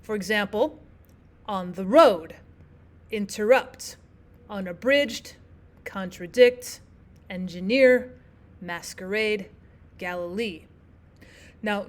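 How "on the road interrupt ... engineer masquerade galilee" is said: "on the road", "interrupt", "unabridged", "contradict", "engineer", "masquerade", "Galilee" each soft, soft, then hard on the last syllable; spaced examples from "on the road" to "Galilee", final syllable stressed in each; anapestic stress. Shown as a demonstration. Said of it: "soft, soft, and then a hard stressed syllable".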